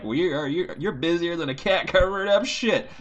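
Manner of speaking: southern folksy phrasing in a mock accent; southern saying. Repeating a Georgia saying in a mock southern accent. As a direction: toss off a country saying with a Georgia twang. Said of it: "analogies like they do down south".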